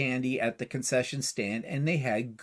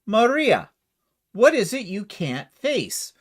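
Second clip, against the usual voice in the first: put-on raised, emphatic Mother Superior line in own accent; acted, higher-pitched nun's exclamation without accent; 6 semitones higher, 5 dB louder, slower than usual. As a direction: address Maria in a higher voice, drawing out the question slowly. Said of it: "Mother Superior looks at her and goes".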